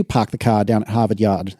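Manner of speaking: joking, lightly put-on Boston accent; exaggerated Boston accent. Podcast host reads listener's joke to John, exaggerated Boston accent. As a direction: drop the r's like a mock Bostonian. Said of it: "jokingly speak the town name with a Boston accent".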